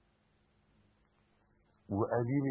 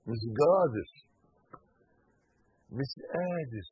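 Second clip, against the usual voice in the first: مش قادر in a Saidi accent; Upper Egyptian accent; 8 dB louder than usual. Shown as a demonstration. Preacher saying مش قادر in a Upper Egyptian accent. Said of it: "بالصعيدي".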